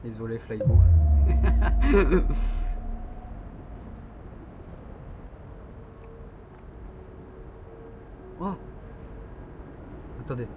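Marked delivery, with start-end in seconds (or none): 0.8-6.3 long breathy fit of laughter; long wheezing laugh. Real, not acted. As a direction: laugh helplessly for a while. Long laugh after apology.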